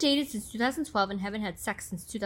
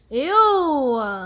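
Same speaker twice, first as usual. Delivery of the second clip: disgusted; 3 semitones higher, 8 dB louder than usual. Real, not acted. Disgusted at text.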